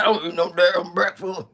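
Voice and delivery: grumpy husband barking at his wife; grumpy old man. Pretend. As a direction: snap back like a cranky old man.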